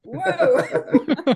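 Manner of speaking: laughing whoa, high. Real, not acted. Runner laughing at own tears, high laughing whoa. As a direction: laugh it out at yourself.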